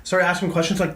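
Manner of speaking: usual speaking voice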